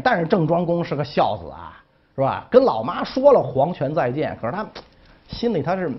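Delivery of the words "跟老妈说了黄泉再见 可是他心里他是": ordinary talk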